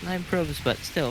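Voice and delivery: ordinary talk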